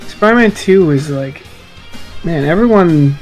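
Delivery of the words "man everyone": ordinary talk, nothing marked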